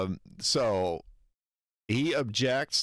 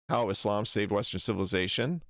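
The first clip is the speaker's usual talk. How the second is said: ordinary talk, nothing marked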